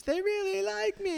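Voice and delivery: very high mock acceptance-speech voice; hammed-up awards speech; Sally Field. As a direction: gush it high like an overwhelmed winner. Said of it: "Sally Field shit when she did that Oscar speech".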